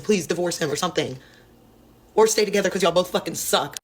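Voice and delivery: ordinary talk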